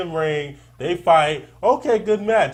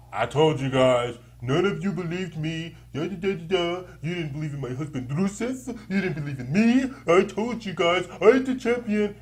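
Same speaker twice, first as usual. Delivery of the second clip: a lightly voiced, smug Lana, pitched higher; gloating Lana; 4 semitones higher than usual. Co-host mocks Lana's imagined champion promo, lightly gloating. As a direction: tease her as an I-told-you-so winner.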